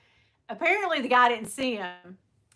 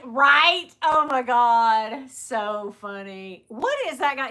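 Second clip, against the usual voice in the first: ordinary talk, nothing marked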